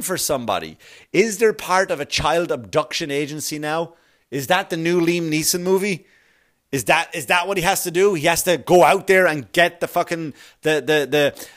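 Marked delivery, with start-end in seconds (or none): none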